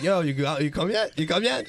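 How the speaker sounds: high, eager mock badgering; retold pestering. Impatient friend pestering during sex.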